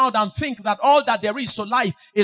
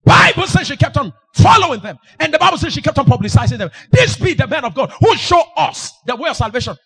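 full-force preaching; 3 semitones higher, 7 dB louder than usual. Acted. Preacher shouting at the congregation. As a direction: thunder it at the crowd.

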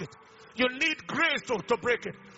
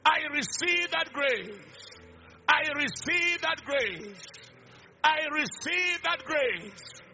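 slow, raised, drawn-out declarations; high, insistent, measured prayer chant; 5 semitones higher, slower than usual. Real, not acted. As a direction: proclaim each phrase slowly for a congregation to echo.

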